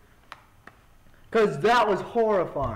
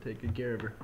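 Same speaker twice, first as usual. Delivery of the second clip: usual speaking voice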